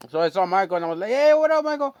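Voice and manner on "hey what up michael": high and loud, calling out to a buddy; hyped greeting. Retold or imitated. Greeting his friend Michael outside, high excited call.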